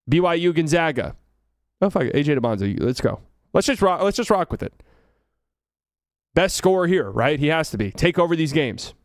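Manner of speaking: usual speaking voice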